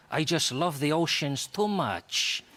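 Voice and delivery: slow, lightly voiced Spanish detective; sincere-sounding excuse, hammed-up; mock Spanish. Detective continues.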